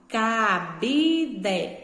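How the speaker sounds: "cabide" in separate syllables, BI strong and long; stress on middle syllable. Shown as a demonstration. Teacher showing a paroxytone word, BI stressed in "cabide". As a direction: break up "cabide", leaning on the middle BI. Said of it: "a palavra que eu pronuncio com mais força".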